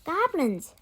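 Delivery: high, loud startled cry; surprised cry. Acted. Christy seeing goblins jump out, startled loud cry. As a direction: yell it as they leap out. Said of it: "Christy cried in surprise".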